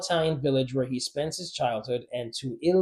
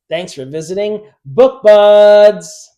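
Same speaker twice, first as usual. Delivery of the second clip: loud; 7 semitones higher, 16 dB louder, slower than usual. Real, not acted. Loud channel sign-off.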